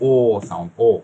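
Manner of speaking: "O" held long and steady; long vowel. Shown as a demonstration. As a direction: draw "O" right out, slowly. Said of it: "সাউন্ডটা লম্বা টান হবে". ("O" লম্বা টান দিয়ে)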